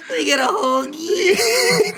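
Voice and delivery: high, drawn-out mock order through laughter. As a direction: stretch it out and ridicule the word. Mocking the word hoagie in an order.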